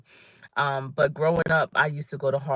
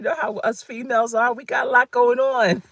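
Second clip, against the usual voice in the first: higher, louder, teasing aside about us females; playful tease; 8 semitones higher, 5 dB louder than usual. Real, not acted. To her viewers, joking about females, playful and higher. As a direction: poke fun at yourself and your sex.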